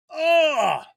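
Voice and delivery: high loud disgusted groan; disgusted ugh. Pretend. Imagining a terrible crossover, loud disgusted ugh. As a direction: groan like the movie is awful.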